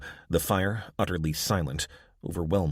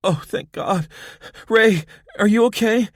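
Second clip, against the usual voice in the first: much higher and louder, breathless relief; relieved and anxious; 9 dB louder than usual. A narrator's reading. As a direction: rush to your hurt sister. Abby sees injured sister.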